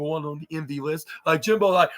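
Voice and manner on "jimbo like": usual speaking voice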